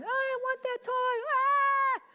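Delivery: hammed-up baby tantrum; whiny baby. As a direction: play a bratty little kid crying for a toy. Crying baby wanting a toy.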